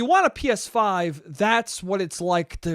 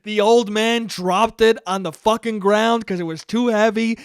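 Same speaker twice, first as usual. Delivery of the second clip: ordinary talk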